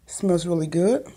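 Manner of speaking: normal talking voice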